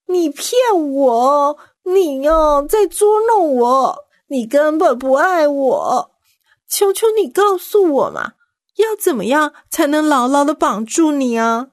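high, pleading and sulky; coaxing whine. A narrator's reading. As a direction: wheedle him like a spoiled lover. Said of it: "又撒娇地对参孙说". (撒娇地哀求)

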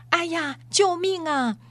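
sudden high startled yelp; cry for help. A narrator's reading. Bree yelping when Aslan's whisker touches him. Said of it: "你知道她为什么叫救命吗".

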